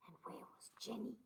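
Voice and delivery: breathless whisper. Acted. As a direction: hush it like danger is near. Suspenseful reading, whispered.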